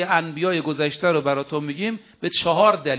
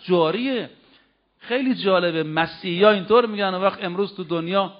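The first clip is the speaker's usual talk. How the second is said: normal talking voice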